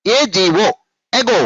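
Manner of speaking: ఏజీఓ run together as one unit; joined letters. Shown as a demonstration. Teacher modelling spelling for pupils, ఏజీఓ joined without breaks. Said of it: "అన్ని కలిపేసి చెప్పేస్తూ ఉండాలి".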